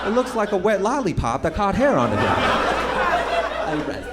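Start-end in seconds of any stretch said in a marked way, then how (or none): 2.2-4.1 high, very slow mocking "I rescue"; mock smug drawl. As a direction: stretch it out like a smug do-gooder. Mocking virtue signaller.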